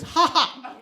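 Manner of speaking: loud, high mock laugh; demonstrated laughter. Shown as a demonstration. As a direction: show them how to laugh, a big high "ha ha". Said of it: "I'd rather just laughter".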